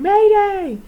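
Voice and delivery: very high, loud squawked cry; bird's alarm cry. A narrator's reading. Wiffle bird raising the alarm, crying out shrilly. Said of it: "the wiffle bird cried".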